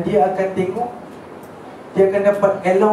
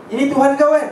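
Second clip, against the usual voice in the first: raised mocking demand of a torturer; mock torturer taunt; 6 semitones higher than usual. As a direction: taunt him like a cruel captor. Torturer taunting slave over ant.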